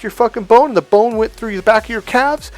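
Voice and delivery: high-pitched sarcastic recap of absurd details; mock incredulous wife. Mock wife absurdly guessing his day.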